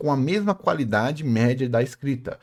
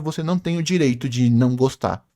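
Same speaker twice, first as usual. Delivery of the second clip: ordinary talk, nothing marked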